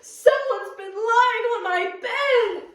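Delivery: Papa Bear upset about his bed, high and loud; outraged Papa Bear. Acted. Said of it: "Papa Bear sputtered".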